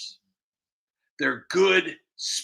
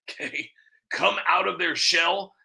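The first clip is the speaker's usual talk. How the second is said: ordinary talk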